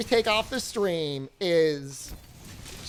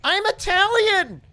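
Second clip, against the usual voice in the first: high, loud and slow, hammed-up demanding wife; wife's outburst; 12 semitones higher, 6 dB louder, slower than usual. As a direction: shout it like a dramatic wife craving pasta. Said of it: "she's like".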